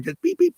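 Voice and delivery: high, light toots imitating a gentle car horn; polite little horn beeps. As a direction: chirp the beeps high like a timid horn tap. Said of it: "not like leans on a horn".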